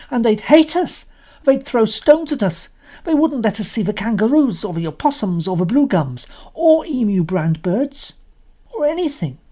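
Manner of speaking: put-on high, loud boy's voice listing grievances; Robert insists to his sisters, a loud indignant boy. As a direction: protest like a cross young lad.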